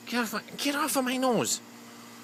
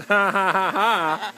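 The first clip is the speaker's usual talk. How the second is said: normal talking voice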